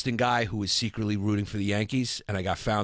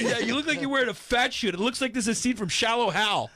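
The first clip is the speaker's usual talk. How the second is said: high, laughing roast; laughing teasing; 13 semitones higher than usual. Real, not acted. Friend roasting host's weight, high laughing teasing. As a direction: tease through laughter.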